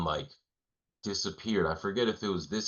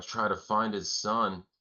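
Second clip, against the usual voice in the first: ordinary talk, nothing marked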